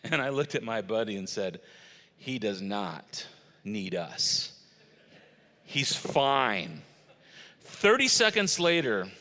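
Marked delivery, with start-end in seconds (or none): 2.2-6.8 low, slow and measured; deadpan confidence, with weighty pauses; calm assurance. Retold or imitated. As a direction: say it slowly, utterly unworried. Calm reply to worried buddy.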